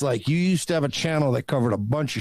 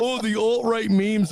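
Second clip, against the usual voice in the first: mocking Baked Alaska; 8 semitones higher, slower than usual. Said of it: "when Baked Alaska did the whole".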